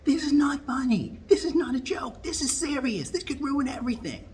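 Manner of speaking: high, far-off caricature rant, breathy at the start; high mock Kamala tirade; ranting and raving. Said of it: "starts ranting and raving".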